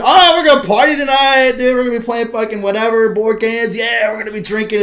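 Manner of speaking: high, loud party hype; hyped party guest. Retold or imitated. Guests hyping a big party night, excited shout. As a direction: pump everyone up for the night. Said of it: "everybody said".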